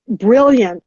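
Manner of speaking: usual speaking voice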